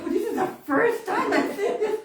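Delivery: high, loud astonished squeal with laughter; amazed. Retold or imitated. Granddaughter watching the oven door open, amazed. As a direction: exclaim it in giddy wonder.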